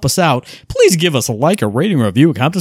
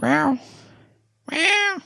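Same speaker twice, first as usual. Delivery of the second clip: high playful meows, climbing each time; cat meow; 5 dB quieter, much slower than usual. Pretend. Playing on voice chat, teasing his friend with rising cat meows. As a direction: meow like a silly cat, higher and higher.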